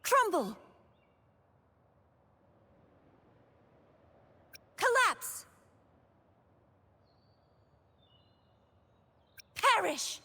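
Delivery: high, sharp one-word combat shouts; attack cry. Acted. Knight striking down an enemy, attack cry.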